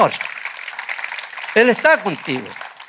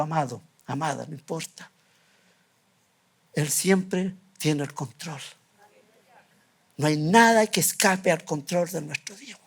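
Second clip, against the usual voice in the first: softer, intimate reassurance to the flock; tender appeal; 6 semitones lower, 7 dB quieter than usual. Acted. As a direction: lower and softer, comfort each listener. Tender closing appeal to congregation.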